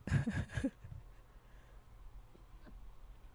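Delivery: breathy high giggle. Real, not acted. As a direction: laugh at yourself. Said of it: "It's kinda seductive".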